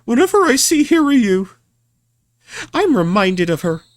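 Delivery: put-on young heroine voice. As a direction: voice the princess. Voices character dialogue.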